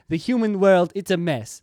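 a clumsy mock Jamaican lilt; hammed-up bad Jamaican accent; terrible Jamaican accent. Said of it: "That was a terrible Jamaican accent".

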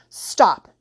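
loud, abrupt order; forceful command. A narrator's reading. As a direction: cut them off sharply. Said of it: "he commanded".

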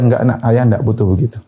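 low, self-effacing brush-off; bashful refusal. Acted. Old father declining his child's offer, bashful. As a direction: turn the offer down shyly, pitched low. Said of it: "Dengan malu dia mengatakan".

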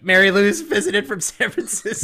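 high, loud laughing plea; mock pleading call. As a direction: beg for help half laughing. Character pleading on phone.

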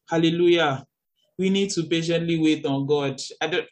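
ordinary talk

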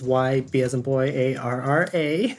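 put-on Y, B as in boy, A R R A named letter by letter; spelling it out. Shown as a demonstration. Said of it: "last name is".

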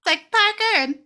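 put-on high, loud, sweet little girl listing toys; sweet kid voice. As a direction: chirp it out like a proud small child. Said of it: "then she's like".

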